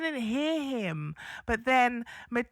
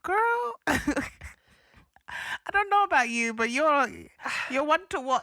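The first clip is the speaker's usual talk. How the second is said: much higher, knowing sassy aside; sassy warning; 4 semitones higher than usual. Retold or imitated. Co-host retelling what she said to the girl, high and sassy. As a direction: tease her with a knowing warning. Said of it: "I was like".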